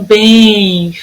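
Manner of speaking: "bem" drawn out long; lingering stress on "bem"; stretched syllable. Shown as a demonstration. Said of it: "foi a sílaba que durou mais tempo".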